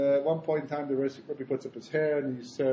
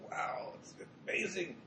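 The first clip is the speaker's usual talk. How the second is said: whispered mock wonder; hushed awe; 2 semitones higher, 14 dB quieter than usual. Chassid hearing the Rebbe was there, whispered awe. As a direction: imitate a disciple breathing out amazement.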